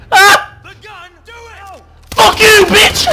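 high, very loud yell; furious shout. Real, not acted. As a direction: scream abuse at the enemy. Attacking in game.

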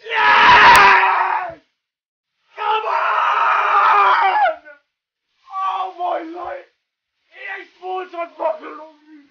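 overjoyed high yelling; ecstatic shouting. Real, not acted. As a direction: scream with pure joy. Gets the player he prayed for.

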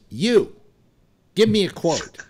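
loud curt order; brusque demand. Retold or imitated. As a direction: bark it at him. Reporter demanding a quote.

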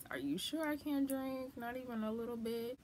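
playful whiny asking; mock pleading. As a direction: coax for just a little bit. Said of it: "another thought that I had was".

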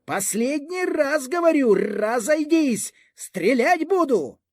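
high, drawn-out military command; threatening last warning. A narrator's reading. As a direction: warn the crowd in a strained high cry. Officer gives last warning to crowd. (погрозливий офіцерський окрик)

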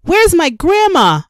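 put-on frantic high child's shout; child yelling over the fire; yelled. A narrator's reading. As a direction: shout for grandma in fear. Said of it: "I yelled".

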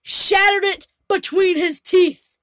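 dramatic slow shout. Pretend. Telling viewers the cat's feat, slow dramatic shout. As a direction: drag it out like a big reveal. Dramatic story of cat biting bulbs.

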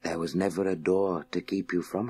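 soft, distant, hushed voice of the Masters; hint of the Masters. The Masters reply inside Scott's mind, soft and distant. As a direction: answer gently from far off, inside his mind. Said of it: "he heard all the Masters reply".

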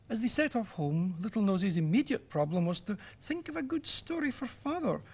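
usual speaking voice

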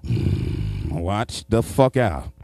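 a low hum then a slow warning; low, slow mock menace; suspicious grunt then warning. Imitating West Virginia drivers.

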